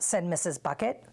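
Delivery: plain reading voice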